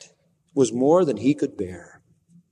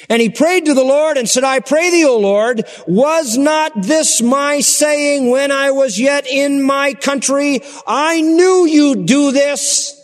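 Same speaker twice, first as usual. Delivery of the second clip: loud, high, slow indignant complaint; furious prophet; 12 semitones higher, 5 dB louder, slower than usual. Acted. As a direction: rail at God, he knew it. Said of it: "he's furious".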